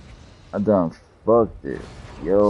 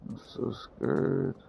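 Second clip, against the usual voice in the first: mock scared whimper; 5 semitones higher, 3 dB quieter, slower than usual. Mocking own fear.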